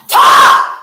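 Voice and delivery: sudden full-force scream; screamed command. A narrator's reading. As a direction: scream the order in one burst. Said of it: "Then I screamed".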